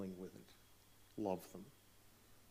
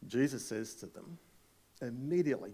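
normal talking voice

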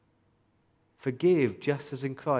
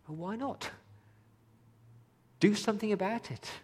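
higher, pointed challenge, mock incredulous; pastor's challenge; 10 semitones higher than usual. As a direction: push them, half-exasperated, higher pitch. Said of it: "and I say to them".

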